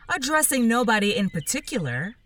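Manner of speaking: usual speaking voice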